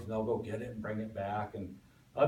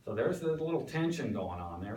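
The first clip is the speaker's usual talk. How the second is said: ordinary delivery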